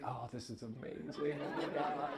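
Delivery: laughing through an amazed aside; laughing delight. Retold or imitated. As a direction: gush with a laugh in the voice. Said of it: "The other half is like".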